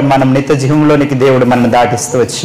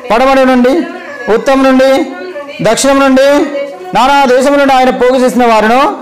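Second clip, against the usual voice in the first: raised, ringing sermon recital, each phrase lifted high; preaching cadence; 7 semitones higher than usual. Acted. Preacher leading his congregation in the psalm, high and ringing. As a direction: call out the verse so the church repeats it.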